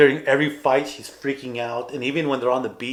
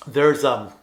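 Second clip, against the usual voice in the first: ordinary talk, nothing marked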